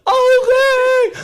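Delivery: very high, loud imitation; mock wailing assent. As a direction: squeal it as a reluctant runner giving in. Runner giving in, imitated.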